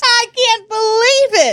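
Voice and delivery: high, disbelieving; real amazement; surprised. Real, not acted. Shocked reaction.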